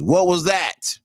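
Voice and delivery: loud, high yell from upstairs; dad calling down from upstairs, loud yell. Retold or imitated. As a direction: yell it up the stairs like an angry father. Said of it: "My dad yells from upstairs".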